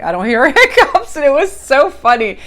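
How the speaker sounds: laughing through the line. Retold or imitated. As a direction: giggle mid-line. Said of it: "she's like".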